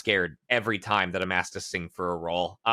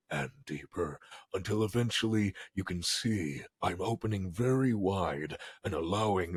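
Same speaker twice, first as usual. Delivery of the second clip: lower, softer voice dropping into the chest; voice sinking down; 5 semitones lower, 5 dB quieter than usual. Shown as a demonstration. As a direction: drop it deeper and deeper toward the stomach. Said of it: "now we're moving it down to the throat".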